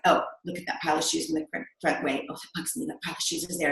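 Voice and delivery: low, hushed grumble to oneself; retold grumbling. Working mom recalls noticing clutter at home, low retold grumble. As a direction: imitate fussing under your breath, low and quick.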